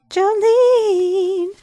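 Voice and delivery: high calling shout of a name. Pretend. Host calling for the missing character, loud and high. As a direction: call her name as if searching.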